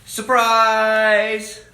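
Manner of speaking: big, loud and high shout of surprise. A narrator's reading. Reader shouting the party surprise to the kids, loud and high. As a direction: burst out with the reveal.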